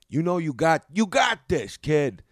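loud, high coach's rallying cry; boxing trainer pumping up. Shown as a demonstration. Trainer to his boxer before the bout, pumping him up loud. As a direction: fire up the fighter, loudly. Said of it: "I'm pumping him up at first".

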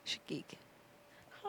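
breathy whisper. Shown as a demonstration. Wife repeating the catchphrase in a whisper. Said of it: "You gotta say it just like that".

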